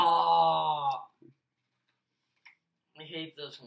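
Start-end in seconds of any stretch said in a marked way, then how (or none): 0.0-1.0 disgusted groan. Real, not acted. Disgusted by flavour.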